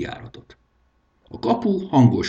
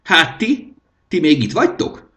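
high, loud surprised king; a high royal voice caught off guard; startled king; 9 semitones higher, 6 dB louder than usual. A narrator's reading. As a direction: snap at them as if they should be gone.

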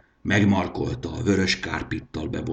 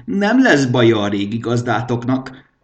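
smug; 4 semitones higher, 6 dB louder than usual. A narrator's reading. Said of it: "önelégült vigyorral".

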